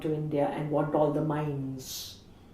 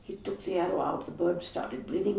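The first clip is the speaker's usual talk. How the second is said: ordinary talk, nothing marked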